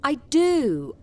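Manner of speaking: ordinary talk, nothing marked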